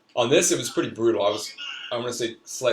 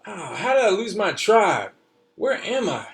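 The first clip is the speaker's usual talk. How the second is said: put-on a young boy telling his adventure, pitched up; child's voice; 8 semitones higher, 5 dB louder than usual. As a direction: read it like an eager little kid. Child narrator reads his story.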